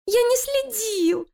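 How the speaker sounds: very high, slow whimper; whining rat. Acted. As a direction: plead like a frightened little rat. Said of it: "захныкал крыса". (хнычущая крыса)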